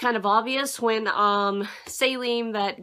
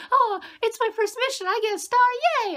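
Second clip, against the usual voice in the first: put-on high, giddy girlish voice, much higher; mock giddy Mimosa; 14 semitones higher, 3 dB louder, faster than usual. Reviewer mocking Mimosa's excitement at her first star, high and giddy. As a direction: mimic her chirpy excitement over a star. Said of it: "Mimosa was like".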